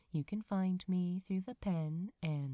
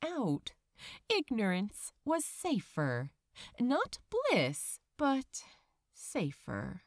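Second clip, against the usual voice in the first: measured, gentle audiobook reading; story narration; 2 semitones higher, 4 dB louder than usual. A narrator's reading. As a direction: tell the story like a bedtime tale. Reads the fanfiction chapter aloud.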